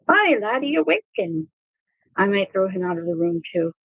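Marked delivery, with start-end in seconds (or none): 0.1-1.5 high, sing-song cat mascot; robot cat voice. Acted. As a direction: greet them like a toy cat. Robot cat looking into eyes.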